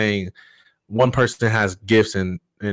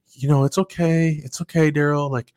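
put-on higher, soothing voice as if calming a small child; talking to a kid; 6 semitones higher than usual. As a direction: imitate her soothing him like a little kid, pitched up. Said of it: "she's talking to me like I'm a kid".

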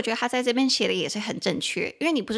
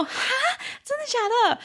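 much higher, a startled huh then really; shocked disbelief. Retold or imitated. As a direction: replay your own jaw-drop at the news. Retelling own shocked reaction.

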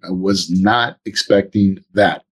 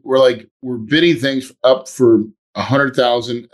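ordinary talk, nothing marked

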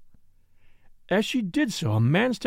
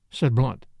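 ordinary delivery

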